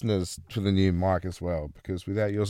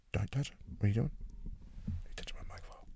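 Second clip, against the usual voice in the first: whisper; 15 dB quieter, much faster than usual. Real, not acted. Friend touches his mic.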